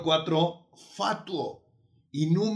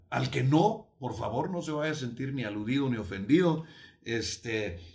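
ordinary delivery

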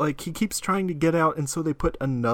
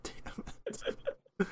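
whispered curse; 5 semitones higher, 12 dB quieter, much slower than usual. Real, not acted. Frustrated aside mid-conversation.

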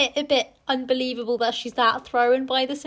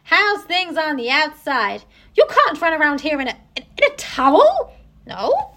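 lightly hammed-up novel dialogue, louder; acted ward greeting; 4 semitones higher, 7 dB louder than usual. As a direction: play the cheeky patient and the nurse. Acting out novel's ward confrontation.

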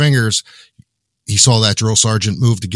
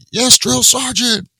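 high, slow barked recruit reply; barked military recruit reply; 3 dB louder, slower than usual. Retold or imitated. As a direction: draw it out like a scared recruit. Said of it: "I was like".

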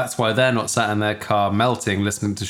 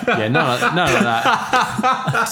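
laughing; 6 semitones higher, slower than usual. Real, not acted. Laughing at the cup holder joke.

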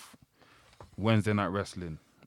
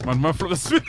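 hype; 5 semitones higher, 6 dB louder than usual. Real, not acted. Hype intro start.

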